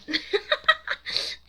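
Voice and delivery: high, loud cackling laugh; burst of laughter. Real, not acted. Reader cracking up at her own script, real laughing. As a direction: crack up helplessly.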